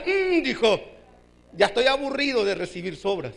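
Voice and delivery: low, falling fed-up grumble; weary grumble. Acted. Preacher voicing Bartimeo fed up with leftovers, weary grumble. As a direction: grumble it as a beggar tired of scraps. Bartimeo's first complaint, acted.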